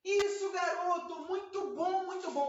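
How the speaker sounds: high, bright praise for a puppy; happy praise. Shown as a demonstration. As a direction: cheer the puppy on. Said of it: "O que que eu faço?".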